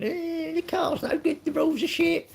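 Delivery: high mocking whine: "Eh, the car's no good"; whinging driver. Mocking F1 drivers to stream chat, whiny high mimicry. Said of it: "they're whinging".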